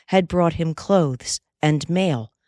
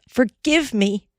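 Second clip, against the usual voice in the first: higher, slow, pleading cry; Molly begging him to forgive her, crying out; 8 semitones higher than usual. Acted. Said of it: "cried the girl".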